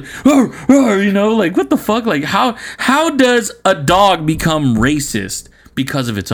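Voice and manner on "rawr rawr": high, loud mock barks; dog barking. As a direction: bark sharply like a dog. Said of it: "he's like".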